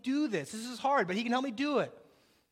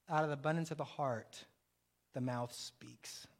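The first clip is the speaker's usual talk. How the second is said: softer, lower, slow whispered verse; hushed whisper; 6 semitones lower, 6 dB quieter than usual. Acted. Pastor whispers the scripture to the congregation. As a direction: let the verse fall to a whisper. Quoting scripture, voice drops away.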